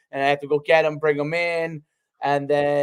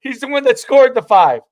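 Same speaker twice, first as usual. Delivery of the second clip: loud, high dismissive answer; mock refusal; 8 semitones higher, 7 dB louder than usual. Kyle telling the blocker they want Will instead, loud high dismissive. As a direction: wave him off, playing Kyle.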